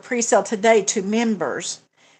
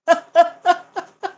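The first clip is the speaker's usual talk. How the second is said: bright burst of real laughter; high laugh; 8 semitones higher, 5 dB louder than usual. Real, not acted. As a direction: laugh out loud at yourself. Laughing at own problem.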